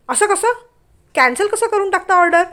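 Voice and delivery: angry protest. Acted. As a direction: object sharply to cancelling. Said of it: "ती रागानेच म्हणाली".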